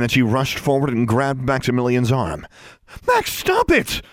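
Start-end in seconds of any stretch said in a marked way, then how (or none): 3.1-4.0 high, horrified shout. A narrator's reading. Girl grabs her rescuer's arm, shouting. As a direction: shout to stop him, pitch soaring. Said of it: "she shouted".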